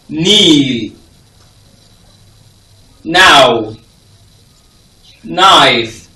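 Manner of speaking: Knee and Knife begun on a held N; Knee, Now, Knife with a strong initial n; silent K. Shown as a demonstration. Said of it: "K is always silent".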